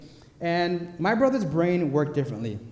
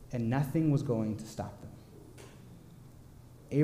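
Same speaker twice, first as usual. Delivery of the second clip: solemn hush; 4 semitones lower, 9 dB quieter, much slower than usual. Acted. Preaching the farmers' resolve.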